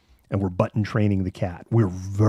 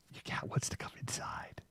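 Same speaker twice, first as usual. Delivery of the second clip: much softer, breathy whisper in disbelief; 17 dB quieter than usual. Retold or imitated. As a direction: hush it like a stunned aside. Repeating kid's line again.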